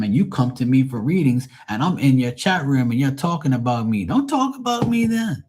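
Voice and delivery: retold outburst. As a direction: fire back at the women who dissed him. I'm like.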